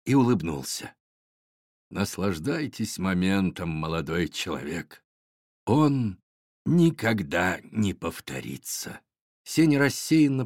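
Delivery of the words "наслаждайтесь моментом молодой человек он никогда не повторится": put-on low, soft, slow old sage; deep Father Frost voice. A narrator's reading. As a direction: speak like a wise ancient wizard. Дед Мороз speaks. (тихий низкий голос мудрого Деда Мороза)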